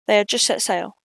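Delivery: ordinary delivery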